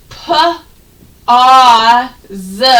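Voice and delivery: p, ah, z said as separate sounds; sounded out. Shown as a demonstration. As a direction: isolate each sound slowly. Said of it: "they both say ah".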